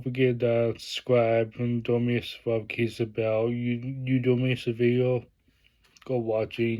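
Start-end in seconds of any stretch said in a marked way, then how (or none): none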